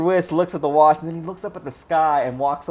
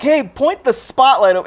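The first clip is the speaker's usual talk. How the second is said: high, loud retold order; raised-voice acted command; poacher shouting orders; 10 semitones higher, 6 dB louder than usual. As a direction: call out like you're directing someone across a field.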